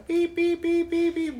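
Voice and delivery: high beep beep sound; imitated car beeping. Driver mocking the car's nagging beeps, high-pitched. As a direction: mimic the car's warning beep. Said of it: "beeps at me every time".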